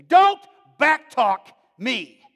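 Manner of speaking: loud, slow, high furious snap; angry mother. Retold or imitated. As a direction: bark it like a raging mom. Mother snapping at son.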